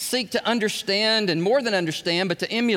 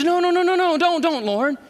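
pleading Moses; 7 semitones higher than usual. Retold or imitated. Moses begging God not to destroy the people, frantic higher pleading. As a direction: beg God desperately to spare them. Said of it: "And Moses says".

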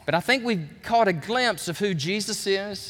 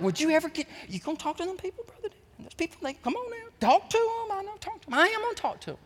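mocking religious churchgoers; 12 semitones higher, 4 dB quieter, faster than usual. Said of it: "some of y'all are like".

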